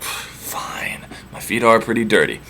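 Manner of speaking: breathy whispered "Fine"; hushed, grudging "Fine" from the disguised hero. Acted. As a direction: let the word fall into a whisper. Odysseus answering Penelope.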